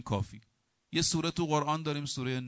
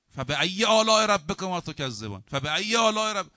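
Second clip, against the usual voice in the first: loud, high-pitched recited refrain; Quran recitation; 5 semitones higher, 7 dB louder than usual. Real, not acted. Preacher quoting the refrain of Surah al-Rahman, loud high recitation. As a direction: declaim the verse with raised voice.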